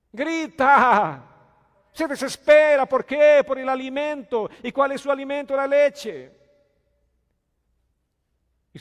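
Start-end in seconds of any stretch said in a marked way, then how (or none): 0.1-6.3 very loud, high shouted preaching; fervent shouting. Acted. Preacher shouting about the baby crying for milk. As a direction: cry it out like a hungry baby.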